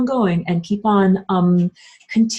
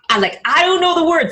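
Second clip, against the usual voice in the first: mock panic; 9 semitones higher, 4 dB louder than usual. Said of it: "Mini Man, you're like".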